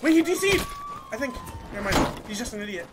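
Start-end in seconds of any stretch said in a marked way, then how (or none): 0.0-0.6 loud, high exclaim; surprised shout. Real, not acted. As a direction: shout out in disbelief. Sudden surprise in the fight.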